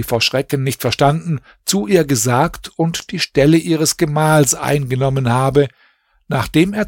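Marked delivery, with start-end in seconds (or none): none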